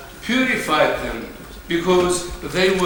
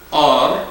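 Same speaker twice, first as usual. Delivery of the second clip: "Ar" hit louder as the stressed syllable; accent on Ar; 5 dB louder than usual. Shown as a demonstration. Teacher isolates the stressed syllable "Ar" for students, loud. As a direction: punch the syllable "Ar". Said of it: "has the accent".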